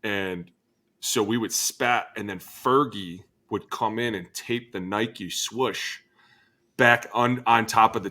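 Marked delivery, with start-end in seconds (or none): none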